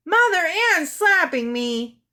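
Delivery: high, loud cry to mother; tattling call. A narrator's reading. Child calls to mother after a slap, loud high tattle. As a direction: complain loudly to Mom.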